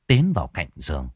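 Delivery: plain reading voice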